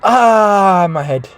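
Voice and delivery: loud, high drawn-out "ah", then falling back; mock crying out. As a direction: imitate someone hamming up an injury. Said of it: "starts crying like a fucking little baby".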